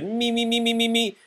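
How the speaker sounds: high, eager me me me; eager shout. Acted. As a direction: beg to go first. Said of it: "Froggy shouted".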